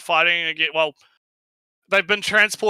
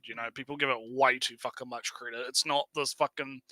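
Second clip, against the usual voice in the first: ordinary talk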